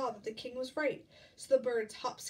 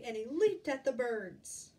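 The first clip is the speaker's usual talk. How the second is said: usual speaking voice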